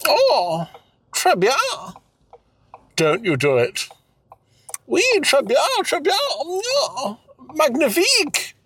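put-on high hammy French accent; fake French. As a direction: ham up a silly French lover. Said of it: "It's got you there".

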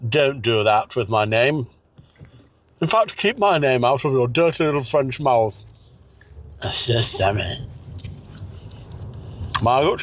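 ordinary talk

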